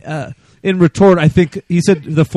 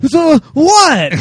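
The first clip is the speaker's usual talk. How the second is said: imitating a flustered angry caller, much higher; mock frustrated outburst; 11 semitones higher, 3 dB louder than usual. Host mimics an angry caller: mock sputtering frustration. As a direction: sputter it like someone too mad to make sense. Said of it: "people get so frustrated and angry that they can't even".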